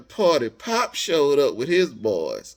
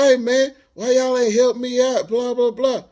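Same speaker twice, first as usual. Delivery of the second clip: higher, louder mock yell at the group; heated retold rant, pitched up; retold angry cussing; 9 semitones higher, 6 dB louder than usual. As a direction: shout it like a stranger who feels wronged. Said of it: "cussing us out".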